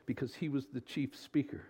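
ordinary talk, nothing marked

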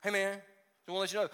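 quick, offhand member's excuse; texting member. Retold or imitated. Churchgoer texting pastor to skip Sunday, quick casual excuse. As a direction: toss it off fast, casual. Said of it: "they'll text me Saturday".

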